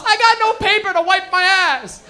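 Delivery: loud, high, slow yell. Retold or imitated. Kid yelling to his mom about the toilet paper, loud slow yell. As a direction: shout it through the whole house.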